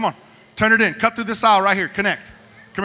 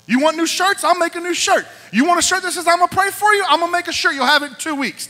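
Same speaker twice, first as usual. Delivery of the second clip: eager promise; 9 semitones higher, 4 dB louder than usual. Real, not acted. Pastor eagerly offering to buy everything.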